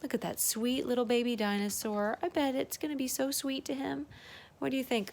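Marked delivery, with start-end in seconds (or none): none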